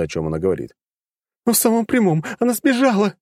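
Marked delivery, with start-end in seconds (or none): 1.5-3.1 high, hysterical shouting mother. A narrator's reading. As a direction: shriek it out in despair. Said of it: "истерично закричала безутешная мать".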